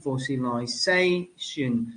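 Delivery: "fossilization" drawn out slowly, weight on SA; slow demonstration of fossiliSAtion; stress towards the end. Shown as a demonstration. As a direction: stretch "fossilization", leaning on the next-to-last syllable. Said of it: "the stress towards the end".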